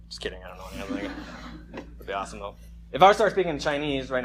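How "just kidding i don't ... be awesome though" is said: quick unvoiced whisper, joking; whispered aside. Real, not acted. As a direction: drop to a fast whisper for the joke. Aside joke after fake claim.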